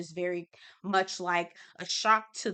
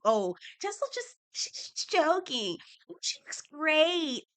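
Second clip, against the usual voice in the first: mocking imitation; 7 semitones higher than usual. Mocking a backpedaling cast member.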